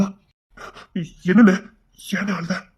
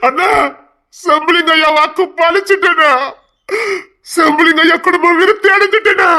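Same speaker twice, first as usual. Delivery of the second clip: high, loud and breaking with tears; joyful tears; tearful joy; 8 semitones higher, 3 dB louder than usual. Acted. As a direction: announce it through happy crying. Son announces birth, overcome.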